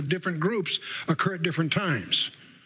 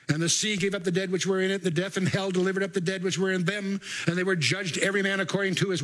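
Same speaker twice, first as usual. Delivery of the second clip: higher, even-pitched recital; scripture reading; 4 semitones higher than usual. Acted. Preacher reads Revelation for listeners to finish, scripture reading. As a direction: read it out, leaving the end hanging.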